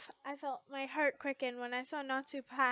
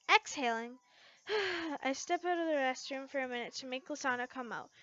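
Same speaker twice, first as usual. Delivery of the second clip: usual speaking voice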